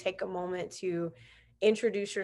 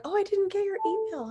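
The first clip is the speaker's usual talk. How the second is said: very high mimicking voice; high-pitched mock excuse; imitating an excusing guest; 13 semitones higher than usual. Mimics guests' excuse about email.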